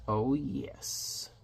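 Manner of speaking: very soft, savoring villain voice; wicked relish. Acted. Queen savours her murder order.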